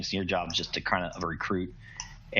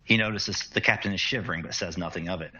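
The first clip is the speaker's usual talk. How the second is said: ordinary delivery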